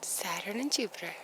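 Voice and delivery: soft hushed whisper; awed whisper. Real, not acted. To her viewers, gazing at the planets, whispered awe. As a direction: breathe the names in awe.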